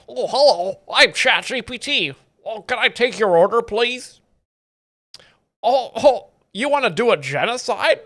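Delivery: high, nerdy AI helper voice; ChatGPT. Acted. Chatbot cheerfully offering help with an awful plan, nerdy impression. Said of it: "You gotta assume these A Is are nerdy".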